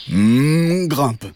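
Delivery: long mooing hum, then the words; cow character. Acted. Cow agrees to the frog's plan, humming cow voice. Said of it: "dit la vache".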